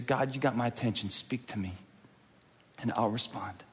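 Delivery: hushed, near-whispered plea; soft prayer. Shown as a demonstration. Leading the congregation's silent prayer, hushed near-whisper. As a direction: barely breathe the prayer.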